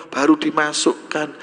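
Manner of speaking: usual speaking voice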